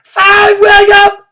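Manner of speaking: the whole class yells the number; shouted cheer. A narrator's reading. Room nine cheering William on, shouting. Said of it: "we shouted".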